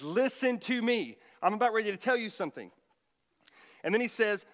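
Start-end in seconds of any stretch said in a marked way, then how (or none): 0.0-2.7 preacher voicing James calling for attention; urgent announcement.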